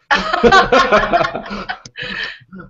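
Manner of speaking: big burst of laughter; loud laugh. Real, not acted. Laughing at his two fans.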